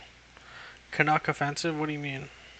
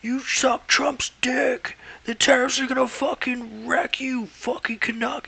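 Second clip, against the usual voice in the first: high, loud, slow mock-furious shout; hammed-up angry tirade at a Canadian, shouted high and slow; hostile insult tone; 9 semitones higher, 6 dB louder, slower than usual. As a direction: yell it like an aggressive bigot picking a fight. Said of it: "it depends on the tone".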